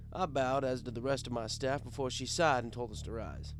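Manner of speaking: ordinary delivery, nothing marked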